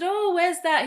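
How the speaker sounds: curious dentist. Retold or imitated. Dental teacher asks.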